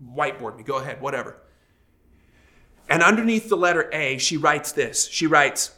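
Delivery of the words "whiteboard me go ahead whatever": softer, lower, grudging brush-off; sulky dismissal. Retold or imitated. Husband grudgingly letting the counselor whiteboard him, sulky. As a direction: mutter it like a sulking husband giving in. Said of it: "I'm like".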